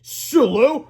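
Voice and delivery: high, loud warning call; stern. A narrator's reading. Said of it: "said Melvin sternly".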